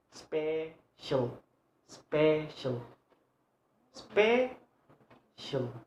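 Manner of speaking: SPECIAL broken into SPE, pause, CIAL; split into syllables. Shown as a demonstration. Teacher counting syllables, SPECIAL split in two. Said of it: "Special have two syllable".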